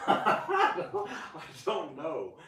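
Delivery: high breathless laugh, then slow words. Real, not acted. To the camera, giggling at forgetting the other drug. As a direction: giggle through it and drag the end. Laughs about drugs list.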